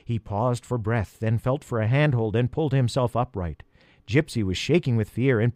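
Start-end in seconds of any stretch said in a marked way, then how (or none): none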